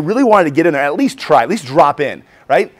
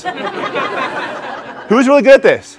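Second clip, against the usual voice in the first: teasing challenge; 9 semitones higher, much slower than usual. Acted. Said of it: "teasing the room".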